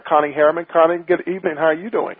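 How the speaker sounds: normal talking voice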